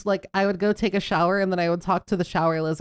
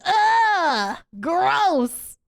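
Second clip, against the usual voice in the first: disgusted; 10 semitones higher, 3 dB louder than usual. Retold or imitated. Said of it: "they're like".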